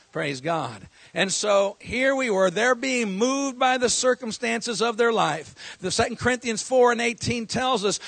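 loud, rising preacher's cadence; fiery pulpit preaching; preaching at full force. Real, not acted. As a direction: build the sermon to a shout. Pastor builds into full preaching.

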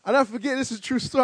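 normal talking voice

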